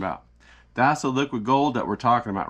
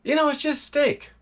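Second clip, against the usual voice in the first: high, offhand shrug of a voice; unimpressed Texan; 12 semitones higher than usual. Retold or imitated. A Texan shrugging at the ribeye pile: high, casual mock voice. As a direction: brush it off like a steak-spoiled Texan. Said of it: "you're thinking".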